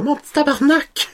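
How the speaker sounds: high-pitched mock scolding wife; shrill hammed-up wife scolding; mock angry wife. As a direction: squeal it like an outraged wife.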